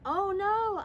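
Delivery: startled gasp. Retold or imitated. Startled child caught by father.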